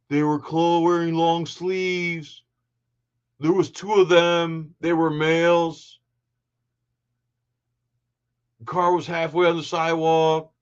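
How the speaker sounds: slow, put-on dumb-cop voice; mock dim cop. Commentator parodies the cop's testimony, slow mocking impression. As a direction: plod through the answers, phrase by phrase.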